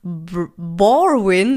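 normal talking voice

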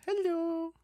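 high sing-song hello to the dog. Shown as a demonstration. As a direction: coo it like talking to a pet. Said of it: "I used my dog voice, which is this".

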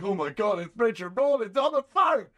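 high, loud starstruck shout; giddy shouted fan excitement; excited. Retold or imitated. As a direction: blurt it out like a fan who can't believe it. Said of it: "sliding off my seat with excitement".

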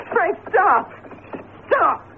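high, tearful begging; crying plea. Acted. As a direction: sob out the plea. Gloria pleading, crying.

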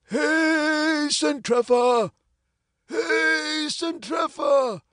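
long, high call across the street; hoarse shout. Acted. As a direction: holler the name, drawing it out. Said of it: "in a hoarse voice".

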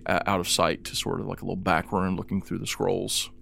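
ordinary delivery

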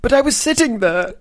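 very high, loud mock whine of protest; hammed-up squeaky complaint; protesting local. Plays displaced local protesting.

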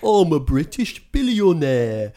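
high, drawn-out mock British accent; stupidly overdone British accent, high and loud; bad British accent. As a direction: ham up a terrible British billionaire accent. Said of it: "everyone in the film is doing a stupid accent".

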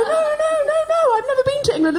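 put-on very high-pitched, fast child's protest. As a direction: plead innocence as a bullied little kid. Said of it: "And I'd go".

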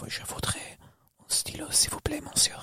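whispered, seductive French request; sultry woman's French. Acted. Imagined woman asking a monsieur for a pen, sultry whisper. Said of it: "If a woman's saying it".

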